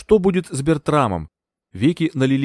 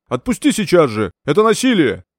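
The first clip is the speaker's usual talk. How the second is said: louder, higher angry yell; 5 semitones higher, 5 dB louder than usual. A narrator's reading. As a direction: shout the doctor down. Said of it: "заорал Бертрам". (гневный крик)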